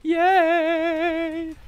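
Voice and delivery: squeaky high cheer. Real, not acted. Celebrating kill.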